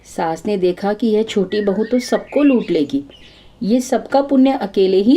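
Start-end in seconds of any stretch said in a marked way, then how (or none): none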